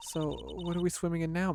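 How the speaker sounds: ordinary talk, nothing marked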